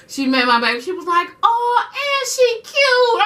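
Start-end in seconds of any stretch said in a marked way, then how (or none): 1.4-3.2 much higher, slow drawn-out pleased surprise; surprised approval. Retold or imitated. Mom meeting the girlfriend, high slow pleased surprise. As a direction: coo it slowly, delighted.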